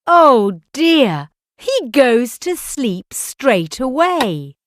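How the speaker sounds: usual speaking voice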